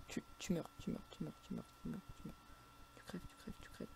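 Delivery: hushed, repeated chant under the breath; tense, breath-only muttering; whispered death wish. Real, not acted. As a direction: whisper it like an urgent incantation. Muttered death wishes during fight.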